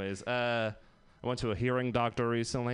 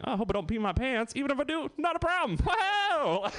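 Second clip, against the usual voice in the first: climbing to a very high squeak; mock giddy squeal; 11 semitones higher than usual. Comic acting out being high in his twenties, rising giddy squeal. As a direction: let it rise into comic carefree glee. Acts carefree self on drug.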